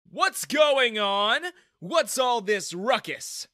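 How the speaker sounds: slow, loud irritated demand; annoyed. A narrator's reading. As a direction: bark at the commotion. Manager steps out annoyed.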